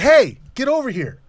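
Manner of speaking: loud, high call across a noisy room; shout. Retold or imitated. Calling his buddy over at the party, loud shout. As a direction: yell him over, sharp and urgent.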